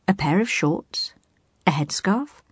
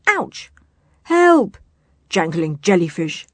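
cries of alarm; 6 dB louder than usual. A narrator's reading. Pirates caught under a falling sail, yelping. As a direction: yelp in pain and shout for help.

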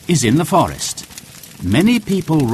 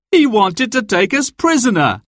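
put-on young man voice; 12 semitones higher, 3 dB louder than usual. A narrator's reading. Will voiced by narrator.

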